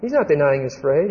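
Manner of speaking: ordinary talk, nothing marked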